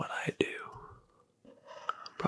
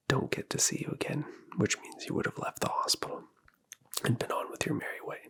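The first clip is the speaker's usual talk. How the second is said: ordinary delivery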